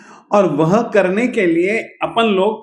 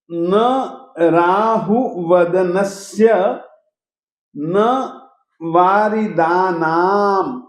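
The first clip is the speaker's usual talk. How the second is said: slow, measured Sanskrit recital; shloka recitation; 2 semitones lower than usual. Shown as a demonstration. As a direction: recite word by word, deliberately. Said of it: "फिर से कहता हूं".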